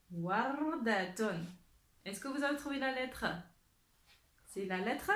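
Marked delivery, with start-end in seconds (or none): 0.1-1.4 very slow model word; lecture appuyée. Shown as a demonstration. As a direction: draw out each syllable of Wardatun. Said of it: "on écoute bien la lettre". (lecture lente et appuyée)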